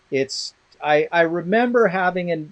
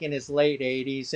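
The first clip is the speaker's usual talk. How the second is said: ordinary talk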